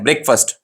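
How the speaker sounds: "ब्रेकफास्ट" run together as BREK-fust; short vowels, no split in "ब्रेकफास्ट"; said as one word. Shown as a demonstration. Teacher models it for students, "ब्रेकफास्ट" as one quick word. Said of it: "एक ही साथ में pronounce करना है".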